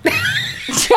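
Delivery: squeaky high burst of laughter; real surprised squeal of a laugh; odd high laugh. Real, not acted. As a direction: let out a strange high laugh. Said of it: "I don't know what that, that laugh was".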